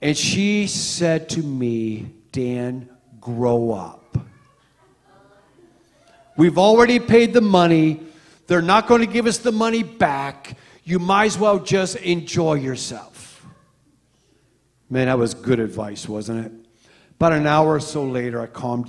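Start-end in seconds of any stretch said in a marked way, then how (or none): 2.3-13.1 louder, firm telling-off from his wife; stern scolding. Retold or imitated. Wife scolding him at the ticket counter, louder. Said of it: "get in my face in a nice way, not so nice way".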